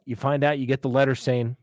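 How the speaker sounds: usual speaking voice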